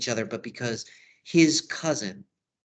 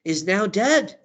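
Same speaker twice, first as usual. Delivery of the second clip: raised, louder mock distress; acted dismay, higher and emphatic; Juliet losing it; 5 semitones higher, 5 dB louder than usual. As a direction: play a bride in a mild panic. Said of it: "Juliet's losing it".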